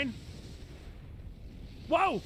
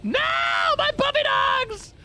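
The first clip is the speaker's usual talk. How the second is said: much higher, louder, drawn-out mock sobbing; hammed-up wail; 13 semitones higher, 5 dB louder, slower than usual. Gamer mock-mourning his lost puppy dogs, hammed-up wailing. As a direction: wail for the lost puppies like a tragedy.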